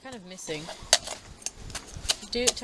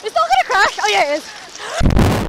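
excited shout; 10 semitones higher, 9 dB louder than usual. Real, not acted. Wave approaching.

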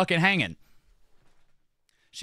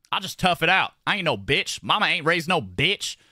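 normal talking voice

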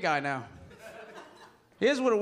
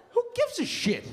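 squeaky outburst. Acted. Mock outrage over bench player.

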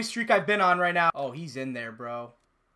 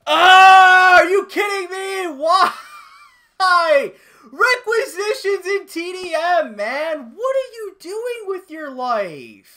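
high, loud wail with stretched words; outraged yelling; 11 semitones higher, 11 dB louder, much slower than usual. Real, not acted. Gamer killed by an enemy in TDM, outraged yelling. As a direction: wail in slow, drawn-out disbelief.

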